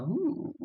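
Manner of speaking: high rising "ooh"; a high-pitched ooh, sliding upward; retold reaction. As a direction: let out a high imitated "ooh". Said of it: "I was like".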